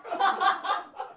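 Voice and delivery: high airy laugh into the voice; high giggle. Acted. Laugh before Holly's voice.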